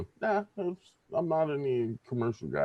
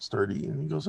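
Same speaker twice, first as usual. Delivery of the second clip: normal talking voice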